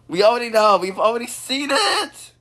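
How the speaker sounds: high, loud protest; rising cartoon outrage; exasperated yell. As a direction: cut the character off, fed up. Fed up with repeated introduction.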